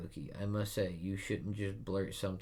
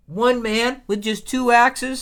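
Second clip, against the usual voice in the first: much higher and louder, slow; amazed repeat; 17 dB louder than usual. Real, not acted. Viewer echoing Usopp in awe, much higher and louder. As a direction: savour the words in awe. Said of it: "awed excited reaction".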